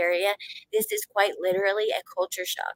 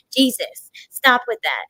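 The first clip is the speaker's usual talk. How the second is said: annoyed commenter; 2 semitones higher, 7 dB louder, slower than usual. Retold or imitated. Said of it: "people be like".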